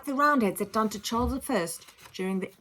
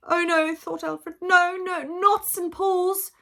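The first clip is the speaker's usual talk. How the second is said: dismayed; 9 semitones higher, slower than usual. Acted. Alfred's horrified thought.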